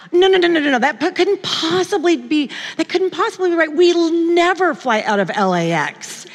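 high-pitched, insistent retold disbelief; panicked denial. Wife rejects husband's news about the airport, acted-out disbelief. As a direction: re-enact refusing to accept it, pitched high.

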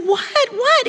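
high-pitched, questioning retold alarm; alarmed disbelief. Wife presses husband for the bad news, high alarmed disbelief. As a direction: re-enact a startled, rising demand.

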